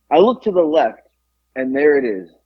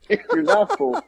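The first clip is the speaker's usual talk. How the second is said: laughing. Retold or imitated. Retelling the deli lady's offer.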